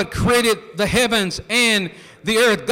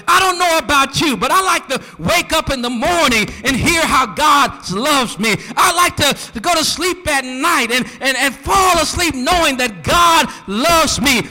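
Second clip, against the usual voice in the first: rising preacher shout; 4 semitones higher than usual. Real, not acted. Preacher whipping up the congregation, rising shout. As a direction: preach it to full force, building.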